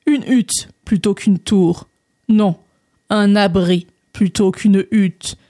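put-on slow, deliberate old frog giving directions; frog character. Frog sending André down the path, slow croaky drawl. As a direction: spell it out slowly, ending on a long croak.

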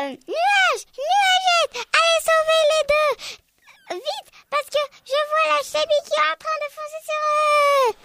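high, urgent calling voice; appel au secours. Acted. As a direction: send the clouds off to the rescue. Robert donne l'ordre de sauvetage. (voix aiguë qui appelle, urgente)

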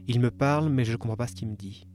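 ordinary talk

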